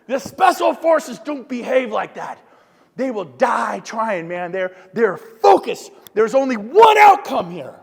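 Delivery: slow, forceful push at the crowd; intense emphasis. Real, not acted. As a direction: drive every word home. Praising elite focus.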